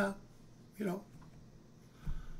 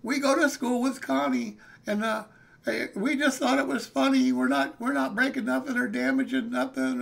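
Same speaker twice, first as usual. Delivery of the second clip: nervous explaining; 5 semitones higher, 3 dB louder than usual. Retold or imitated. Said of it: "I'm telling him".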